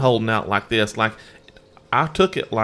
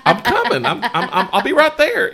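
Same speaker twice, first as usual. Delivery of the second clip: laughing mock hurry; 6 dB louder than usual. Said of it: "I was like".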